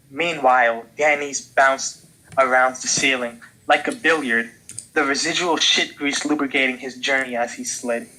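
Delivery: usual speaking voice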